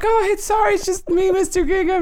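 high, hollered apology; acted high-pitched shout; mock scream. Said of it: "you just scream like".

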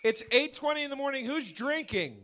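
high, loud call to the audience; rousing the crowd. Real, not acted. Host getting a morning screening audience's energy up, rousing shout. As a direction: shout it out to fire up the room.

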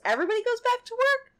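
put-on a boy who can't believe his ears; surprised teen boy. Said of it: "And he goes".

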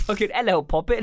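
a quick mock Jack Sparrow; Jack Sparrow accent. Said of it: "Jack Sparrow".

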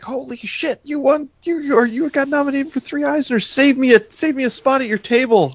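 hammed-up high-pitched excitement; Felix, excited. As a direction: act out a friend's thrilled text in a high voice. Acts out Felix's excited texts.

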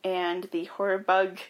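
usual speaking voice

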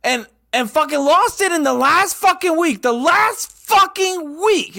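high, loud exasperated rant, voice cracking upward; retold frantic yelling. Retelling to friends his rant at his wife, high furious yell. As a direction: scream it like you just blew the whole season. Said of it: "she heard me yelling".

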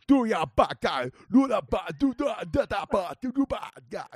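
hammed-up Jamaican gibberish; Jamaican patois impression. Comic mocking how Jamaican English sounds, Jamaican accent.

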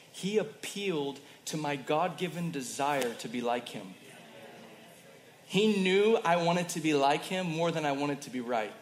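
normal talking voice

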